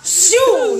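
high drawn-out whoosh noise; playful swooshing sound effect; imitated whoosh. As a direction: voice a ghostly vanishing whoosh.

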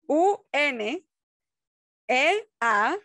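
U N E A S spelled letter by letter, loud; spelling it out. Shown as a demonstration. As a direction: spell out U N E A S slowly and clearly. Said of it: "te voy a deletrear la palabra".

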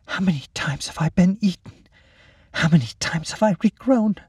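high, distant inner voice; small inner voice. Acted. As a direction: wonder aloud in a small voice. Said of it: "A small voice spoke for the first time within her".

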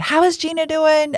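bright high-pitched imitation of the woman; lightly voiced cheerful stranger; high chirpy quote. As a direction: lift the voice way up for her. Woman asking about Gina.

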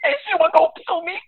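very high, fast sobbing impression; mock crying falsetto. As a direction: wail it in a high crying voice like a meltdown. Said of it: "he was, like, freaking out".

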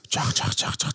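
whispered cheese-squirt sound; imitated sizzle. Shown as a demonstration. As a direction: hiss out the sauce squirting. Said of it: "تسمع صوت التشيز؟".

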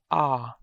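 "ah." as an open vowel, jaw dropped. Shown as a demonstration. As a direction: let the jaw fall open on "ah.". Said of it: "drop your jaw when you pronounce the sound".

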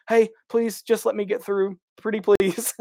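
high, sweet pleading voice; overly polite pleading. Retold or imitated. To fans by the goalpost, high polite pleading. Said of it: "and then I'm being polite".

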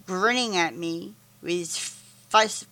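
ordinary delivery, nothing marked

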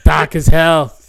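slow, loud bad Boston with dropped R; mock Boston accent. Blackface fan insisting on the color, mock Boston accent. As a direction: punch it out in hammy Boston. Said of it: "Boston accent".

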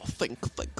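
breathy whisper, murmuring to himself; whispered Pooh thinking. Retold or imitated. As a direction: whisper it like a puzzled bear. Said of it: "He would have to stop and he would go".

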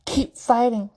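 usual speaking voice

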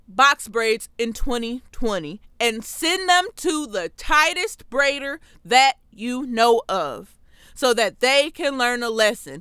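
usual speaking voice

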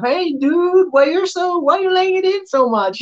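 a high, startled inner voice; inner thought. Retold or imitated. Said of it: "I'm thinking myself".

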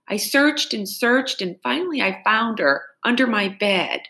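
put-on high whispered frightened child; a scared little girl telling her story. Frightened girl telling the constable her lie.

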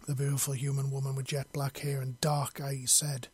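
usual speaking voice

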